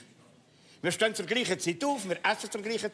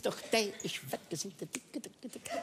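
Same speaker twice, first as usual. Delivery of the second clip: whispering; 11 dB quieter than usual. Acted. Fading whisper at end.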